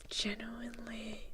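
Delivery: usual speaking voice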